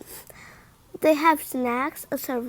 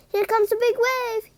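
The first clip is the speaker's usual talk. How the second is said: shouting; 8 semitones higher than usual. Acted. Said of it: "he shouts".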